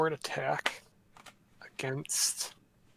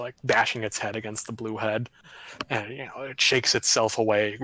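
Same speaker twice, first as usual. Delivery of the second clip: usual speaking voice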